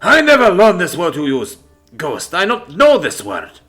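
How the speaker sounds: put-on loud, accented protest; shouting. A narrator's reading. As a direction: protest hotly in a thick Greek accent. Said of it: "he shouts".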